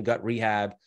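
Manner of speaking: usual speaking voice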